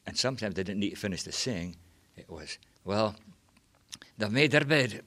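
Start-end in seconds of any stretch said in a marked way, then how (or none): 2.8-5.0 raised emphatic voice; loud. Retold or imitated. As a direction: say it loud and knowing. Quoted saying, loud.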